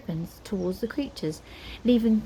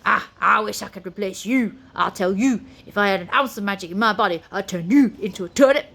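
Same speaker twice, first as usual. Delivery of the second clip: grumbling Scottish warrior; 4 dB louder, faster than usual. A narrator's reading. Spoon threatening, Scottish.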